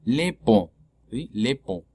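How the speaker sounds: "pont" with a closed, piggy O; "pont" ending on the closed O, T silent. Shown as a demonstration. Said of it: "Le pont es una O cerrada".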